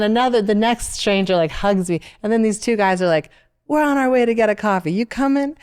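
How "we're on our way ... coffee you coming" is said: higher, louder lightly voiced strangers; friendly invitation. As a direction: invite her along breezily. Two strangers invite her along.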